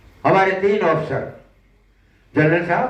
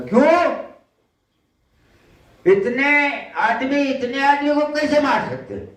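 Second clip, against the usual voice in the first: higher-pitched, mildly indignant challenge; retold protest; 7 semitones higher than usual. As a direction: question the threat in disbelief, voice raised in pitch. Retold protest at court martial threat.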